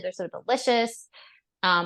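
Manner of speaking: ordinary talk, nothing marked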